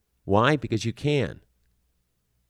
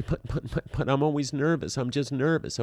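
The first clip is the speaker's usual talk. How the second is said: high, stammering mock protest; nervous objector; 6 semitones higher than usual. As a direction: imitate someone making nervous excuses. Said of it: "And if you tell me".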